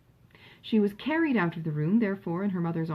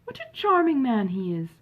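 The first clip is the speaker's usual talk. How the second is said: higher and louder, full of admiration; gushing cry; 6 semitones higher, 5 dB louder than usual. A narrator's reading. Elder sister praising Sir John, a gushing exclamation. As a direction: exclaim it with rapture. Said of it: "cried the elder sister".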